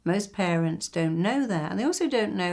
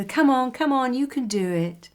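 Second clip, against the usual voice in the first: higher and louder, urging shout; cheering a racer on; 7 semitones higher, 4 dB louder than usual. Retold or imitated. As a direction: yell encouragement from the trackside. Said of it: "we would go".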